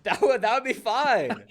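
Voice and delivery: high, loud, drawn-out eager reply; excited. Real, not acted. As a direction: jump on the offer, voice shooting up. Eager reply about sponsor's free shirt.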